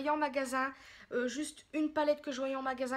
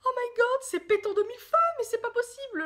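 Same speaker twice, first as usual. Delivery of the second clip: high, loud squealing excitement; loud, pitched-up amazement; ecstatic disbelief; 11 semitones higher, 9 dB louder than usual. Real, not acted. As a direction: shriek with delight at the colour. Bowled over by the yellow.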